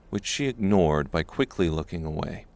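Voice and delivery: usual speaking voice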